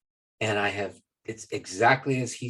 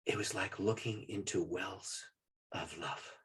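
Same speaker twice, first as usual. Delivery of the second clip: hushed; 7 dB quieter, slower than usual. Retold or imitated. Dad describes seeing Jesus.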